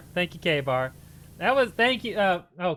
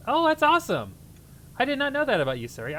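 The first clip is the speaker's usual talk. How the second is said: high, loud excited reaction; light up at surprising news; impressed, pitched-up exclamation; real delighted surprise; 11 semitones higher, 6 dB louder, faster than usual. Real, not acted. Delighted at viewer's job news.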